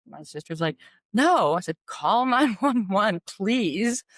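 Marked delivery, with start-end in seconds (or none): 1.1-1.5 higher, louder retold no; defiant refusal. Sister refusing to call nine one one, defiant. 1.9-4.0 slow, higher, insistent plea; exasperated plea. Retold or imitated. Telling her sister to call for help, exasperated.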